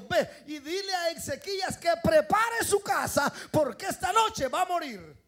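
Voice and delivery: booming divine command; God commanding the prophet, loud and high; shouted command. Retold or imitated. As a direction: bellow it out as God's order.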